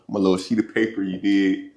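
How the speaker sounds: usual speaking voice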